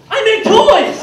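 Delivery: blurting it out proudly; proud toy boast. Retold or imitated. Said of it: "First person I meet".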